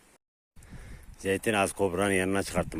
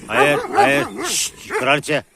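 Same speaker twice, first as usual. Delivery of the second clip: loud, raised command to a dog; sharp scolding; 7 semitones higher, 6 dB louder, slower than usual. Real, not acted. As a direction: tell the dog off, high and loud. (yüksek sesle azarlama)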